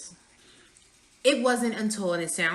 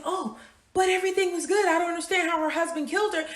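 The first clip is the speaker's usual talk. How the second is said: high, quick faux-shocked gasp; mock surprised onlooker; 8 semitones higher, faster than usual. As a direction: play the clueless neighbour.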